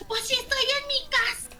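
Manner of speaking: high mocking girl's voice; sneering impression of the neighbour.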